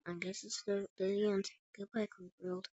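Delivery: very soft, defeated goodbye; resigned farewell. Acted. Trapped by the giant, saying last words, hushed and resigned. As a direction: let it sink almost to nothing.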